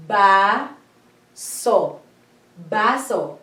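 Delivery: Ba, then so, then Baso joined, open a vowels; syllable by syllable. Shown as a demonstration. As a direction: split Baso into ba and so, then blend. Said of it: "Look at this one".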